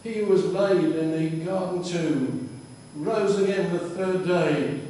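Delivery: usual speaking voice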